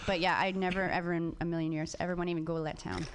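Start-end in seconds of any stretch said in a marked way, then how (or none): none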